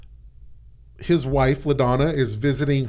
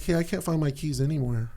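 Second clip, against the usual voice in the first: softer, mild 'Hey, I can't find my keys anywhere'; gentle, not mean; 8 dB quieter than usual. Retold or imitated. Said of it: "I was not mean".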